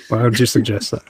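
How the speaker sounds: usual speaking voice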